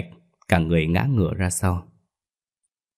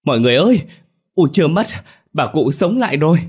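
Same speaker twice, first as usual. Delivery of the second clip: much higher, louder shout of joy; joyful shout; 9 semitones higher, 6 dB louder, faster than usual. Acted. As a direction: call the whole house that she lives. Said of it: "Ông hét toáng lên". (hét toáng lên mừng rỡ)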